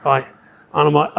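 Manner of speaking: ordinary talk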